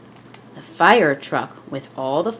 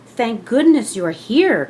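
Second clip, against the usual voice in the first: relieved cry; 5 semitones higher, 4 dB louder than usual. Acted. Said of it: "cried the cook".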